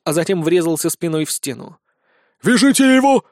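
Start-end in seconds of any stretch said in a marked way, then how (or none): 2.4-3.2 loud, high urgent order; exclaimed command. A narrator's reading. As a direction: shout the order to the team. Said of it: "воскликнул Спирт". (громкий приказ)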